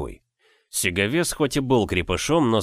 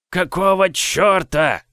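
high, loud, slow squeaky giant's voice; a huge brute with an odd squeaky voice; 9 semitones higher, 5 dB louder, slower than usual. Acted. Giant squeaks angrily at the boy who struck him. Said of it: "своим странным песклявым голосом".

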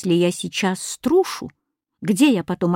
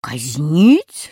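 questioning; 5 semitones lower, 4 dB louder than usual. A narrator's reading. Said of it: "спросил Кузя".

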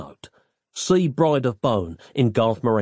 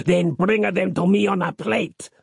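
higher-pitched boss's command; ordering; 6 semitones higher than usual. A narrator's reading. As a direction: issue the order to your sons. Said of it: "ordered Giuseppe".

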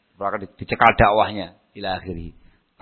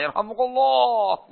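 high, slow, softer echo; echoing troop chorus; 13 semitones higher, 4 dB quieter, slower than usual. Retold or imitated. Blessing echoes among the troops, mock resounding chorus. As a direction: stretch it high like a resounding crowd.

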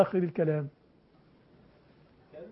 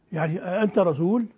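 usual speaking voice

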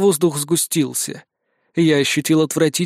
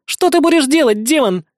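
higher, louder stern challenge; stern demand; 11 semitones higher, 4 dB louder than usual. Acted. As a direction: confront the demon sternly. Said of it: "сурово спросила Кикимби". (суровый вопрос)

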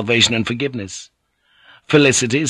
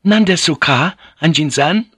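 startled question; 7 semitones higher, 4 dB louder than usual. A narrator's reading. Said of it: "both women said in the same breath".

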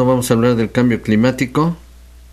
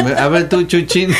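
laughing; 7 semitones higher than usual. Real, not acted. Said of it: "teasing partner laughing".

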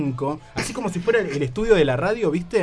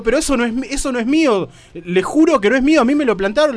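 high, loud desperate plea; pleading; 7 semitones higher, 6 dB louder than usual. Retold or imitated. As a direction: swear it's not yours, pleading. Pleading innocence to interrogator. (súplica aguda y fuerte ante el interrogador)

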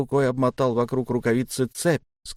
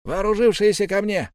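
high-pitched rallying call; commanding call across the room; calling out orders; 9 semitones higher, 3 dB louder than usual. A narrator's reading. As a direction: summon the armed men. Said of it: "послышался голос коротышки Яки".

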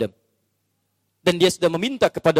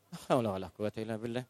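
murmured invocation; 8 semitones lower, 14 dB quieter, faster than usual. Real, not acted. Pious exclamation.

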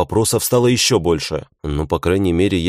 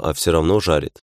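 ordinary delivery, nothing marked

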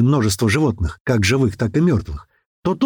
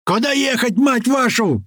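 high, strained frantic yell; 11 semitones higher than usual. A narrator's reading. Driver demanding directions from the crew, yelling. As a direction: yell the cursing question, pitched way up. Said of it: "заорал Рыжий".

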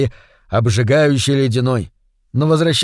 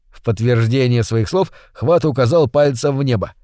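ordinary delivery, nothing marked

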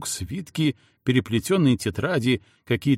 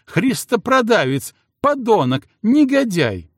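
гневная брань; 7 semitones higher, 5 dB louder than usual. Acted. Жена в ярости бранит мужа.

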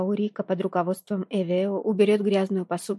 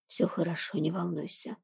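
much softer, tender inner voice; soft reassurance; 2 semitones lower, 8 dB quieter than usual. A narrator's reading. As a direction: soothe her quietly, in thought. Lover reassures her in thought.

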